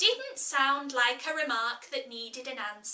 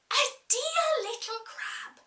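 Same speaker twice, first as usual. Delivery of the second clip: put-on high, delighted girl's voice; Alice, charmed; 12 semitones higher than usual. Alice thinking of the crab, high and charmed. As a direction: coo over the idea like a child.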